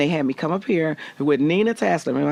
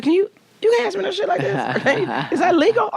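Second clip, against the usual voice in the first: high, laughing mock outrage; mock indignant. To the casting executives, laughing high mock outrage. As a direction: squeal it out in fake indignation. Indignant retort in job interview.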